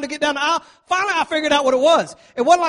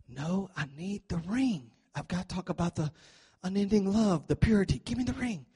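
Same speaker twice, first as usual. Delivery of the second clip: hushed pastor reply; 4 semitones lower, 11 dB quieter than usual. Retold or imitated. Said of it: "to the pastor".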